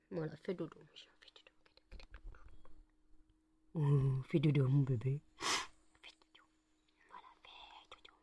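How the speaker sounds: soft whispered lullaby to dog; whispering over a crib. Real, not acted. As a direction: hush the puppy to sleep. Lulling dog to sleep.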